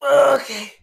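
forced out mid-lift, high and loud; strained effort. Real, not acted. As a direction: squeeze the word out under strain. Lifting heavy dumbbells.

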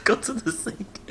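high, slow, urgent order; urgent high command. Real, not acted.

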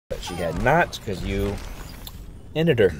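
hoarse, sick-sounding voice. Real, not acted. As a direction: talk through a cold. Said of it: "Sorry, my voice sounds like crap. I'm sick today".

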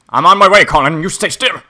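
loud, high rallying shout; urgent call. Pretend. Calling to Colin while going to rescue him, urgent shout. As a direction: holler it across the game world.

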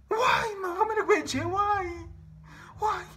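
high, pleading lament; anguished wail. Real, not acted. Asking why death must come, a high anguished wail. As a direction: wail the question, pitched far up.

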